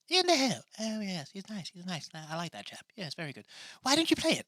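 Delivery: high put-on comic voice, approving; high, silly alter-ego voice; high-voiced sidekick. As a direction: coo approval in a high character voice. Same put-on character voice.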